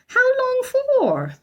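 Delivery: high, slow, alarmed question; alarmed housekeeper. A narrator's reading. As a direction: drag it out in dismay. Mrs Bird alarmed.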